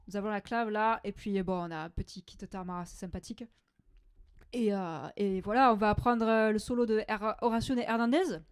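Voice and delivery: loud, bright southern French accent demo; French teacher from the south of France, hammed up, loud. Shown as a demonstration. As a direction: play up the Marseille twang like a chatty local. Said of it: "this is an accent from the south of France".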